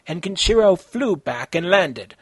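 ordinary delivery, nothing marked

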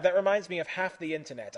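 ordinary delivery, nothing marked

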